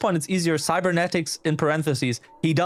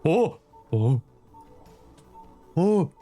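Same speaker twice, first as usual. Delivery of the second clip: startled gasp; 2 semitones higher, much slower than usual. Acted. Reacting to emergency.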